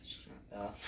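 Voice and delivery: whisper. Real, not acted. Whispered reply.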